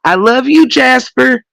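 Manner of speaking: high, sugary family goodnight; Waltons family goodnight. Retold or imitated. Mocking The Waltons family with a high sugary goodnight. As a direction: coo it like a sweet TV family. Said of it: "And in that movie".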